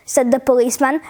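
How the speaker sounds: ordinary delivery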